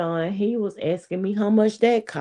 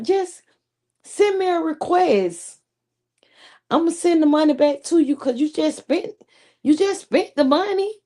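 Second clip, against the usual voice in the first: imitating her man; 9 semitones higher than usual. Man insisting she send a Zelle request, high mock. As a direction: play him up high and eager. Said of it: "he was like".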